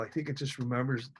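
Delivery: quiet, choked-up memory; holding back tears while remembering; getting emotional. Real, not acted. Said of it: "I don't know why I'm getting emotional".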